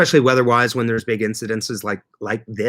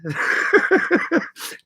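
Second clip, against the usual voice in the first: high airy laugh. Real, not acted. Laughs at his own joke.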